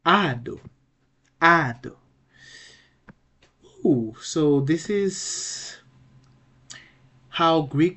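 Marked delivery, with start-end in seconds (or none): 0.0-2.0 "ado" with a loud, high first syllable; pronunciation model. Shown as a demonstration. Teacher giving the right pronunciation, "ado" stressed firmly on the first syllable. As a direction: punch the A of "ado", drop on the second syllable. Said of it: "the right pronunciation will be".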